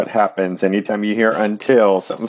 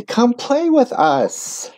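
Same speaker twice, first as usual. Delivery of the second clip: very high, slow sing-song invite; a high, drawn-out friendly call; friendly invitation; 12 semitones higher, much slower than usual. Acted. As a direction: welcome the newcomer gently. The beings invite neither.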